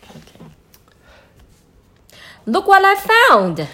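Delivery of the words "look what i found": high, loud triumphant cry; proud shout of discovery. Acted. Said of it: "she shouted triumphantly".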